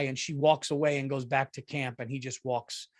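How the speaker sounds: ordinary delivery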